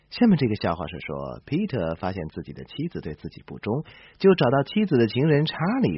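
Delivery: ordinary delivery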